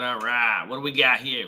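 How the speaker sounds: ordinary delivery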